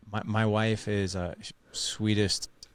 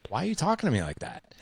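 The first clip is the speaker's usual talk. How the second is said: hurt complaint; 8 semitones higher, faster than usual. Retold or imitated. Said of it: "And I'm like".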